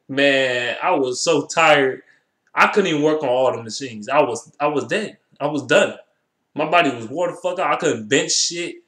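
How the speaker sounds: ordinary talk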